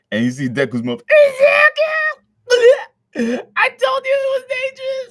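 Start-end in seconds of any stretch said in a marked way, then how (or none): 1.1-5.1 much higher, louder, sobbing shriek; crying mother. Acted. Bakugo's mom screaming at her son, sobbing shriek. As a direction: wail it like a frantic mom. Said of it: "that was Bakugo's mom".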